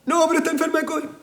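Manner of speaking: high, whiny mock sob; mock crying plea. Preacher mocks a crying member asking for prayer. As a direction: imitate a tearful church member, high.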